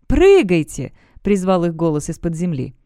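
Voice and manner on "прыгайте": urging shout. A narrator's reading. Voice from underground urges them.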